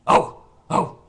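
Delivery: loud breathy mock gasp; hammed-up startled oh oh. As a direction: act out his shocked reaction. Mimicking waiter's reaction.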